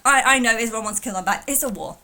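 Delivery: ordinary talk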